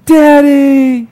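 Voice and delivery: put-on high, loud drawn-out kid's yell; child calling Daddy. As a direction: holler for Dad from far upstairs. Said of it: "all of a sudden you hear".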